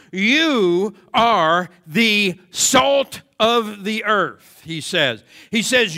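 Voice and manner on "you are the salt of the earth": "You" held long and loud, then each word slow; very slow, loud preaching, leaning on "You"; stress on YOU. Real, not acted. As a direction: hit "You" hard and space out the rest. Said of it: "The emphatic word is you".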